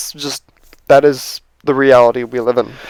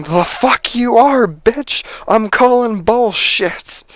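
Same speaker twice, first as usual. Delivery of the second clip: higher, slow angry yell at Rey; slow furious mock yell, pitched up; mock screaming old lady; 7 semitones higher, slower than usual. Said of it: "start screaming at her, going".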